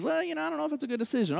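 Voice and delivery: mock noncommittal counsellor; hesitant advisor. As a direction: rattle off a gentle doubt under your breath.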